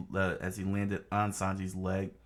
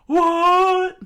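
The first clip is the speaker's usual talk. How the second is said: very high, very loud "what"; shocked shout; 16 dB louder than usual. Acted. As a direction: blurt in shock. Usopp shocked.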